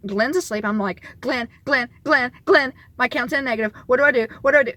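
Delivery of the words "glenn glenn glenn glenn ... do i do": loud, urgent calling of the name; panicked calling. Retold or imitated. Waking her sleeping partner about the bank account, panicked loud calls. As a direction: wake him up in a panic. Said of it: "I'm freaking out. I'm crying".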